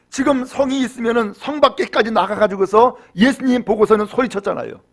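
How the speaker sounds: ordinary talk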